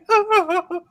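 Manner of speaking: high burst of laughter. Real, not acted. Laughing at the mock.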